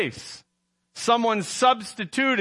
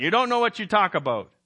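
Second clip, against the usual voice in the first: put-on light French Canadian accent, quick and curt; a quick, rude brush-off in a French Canadian accent; 3 semitones higher, faster than usual. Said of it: "that French accent".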